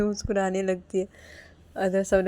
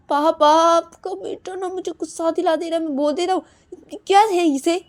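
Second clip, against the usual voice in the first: high, loud tattling outburst; angry complaint; 10 semitones higher, 9 dB louder than usual. Acted. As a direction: complain to father in a raised voice. (गुस्से में ऊँची आवाज़ में शिकायत)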